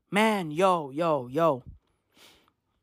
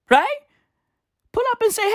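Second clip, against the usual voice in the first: ordinary delivery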